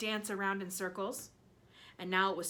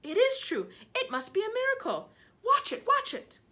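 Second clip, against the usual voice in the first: excited; 14 semitones higher, 7 dB louder than usual. Acted. Aunt frantic at miracle.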